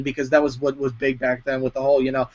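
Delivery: ordinary talk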